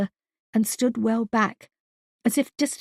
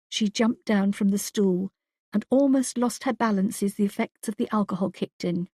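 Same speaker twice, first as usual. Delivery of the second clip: ordinary talk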